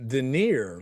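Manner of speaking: "denier" said den-EER, ending like "near"; corrected pronunciation. Shown as a demonstration. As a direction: say "denier" to rhyme with "veneer". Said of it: "I guess it's not denier".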